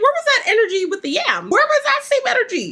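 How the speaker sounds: high, laughing disbelief; incredulous callout. Retold or imitated. Mocking the vendor's sudden bargaining in high disbelief. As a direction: call him out with a laugh.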